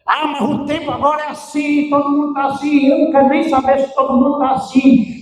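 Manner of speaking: protesting in someone else's voice; mimicked excuse. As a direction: imitate someone making excuses, voice lifted.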